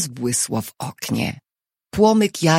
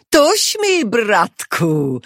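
loud, high-pitched opening that drops away; triumphant taunt, starting high; gloating guard; 6 semitones higher, 4 dB louder, much slower than usual. A narrator's reading. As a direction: crow over a caught man. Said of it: "powiedział gwardzista prosto w jego ucho".

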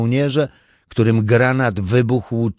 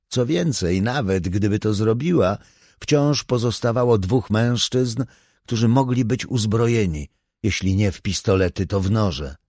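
ordinary delivery

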